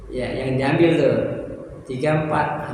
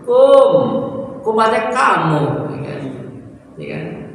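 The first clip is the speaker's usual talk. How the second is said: high, loud scoffing mimicry; mocking clipped greeting; 6 semitones higher, 5 dB louder than usual. Shown as a demonstration. Teacher mocks a lazy "Alaikum", loud and scoffing.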